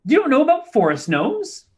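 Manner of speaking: high, offended little gnome; indignant gnome. Acted. Gnome offended the visitors never heard of forest gnomes. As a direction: scoff at the ignorance.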